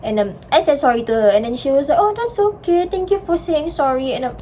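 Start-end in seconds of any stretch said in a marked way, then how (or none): 2.0-4.1 much higher, sweet forgiving reply; lightly voiced forgiving friend; gracious classmate. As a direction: voice the friend accepting the apology kindly.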